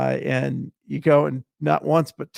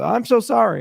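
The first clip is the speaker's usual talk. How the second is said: quick, high, louder retold apology; flustered apology; 9 semitones higher, 7 dB louder than usual. Guest apologises to the woman he walked in on, flustered. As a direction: blurt out a startled apology.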